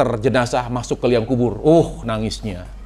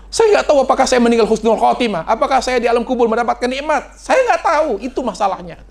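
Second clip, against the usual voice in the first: high, fretful lament; anxious Utsman; 11 semitones higher than usual. Retold or imitated. Preacher voicing Utsman unsure of a good death, high fretful lament. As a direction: pour out worry in a raised voice.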